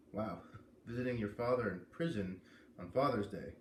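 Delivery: usual speaking voice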